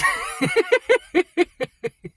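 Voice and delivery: high wheezy burst of laughter; real high-pitched laugh. Real, not acted. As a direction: crack up at your own insult. Laughs at own fat-ass remark.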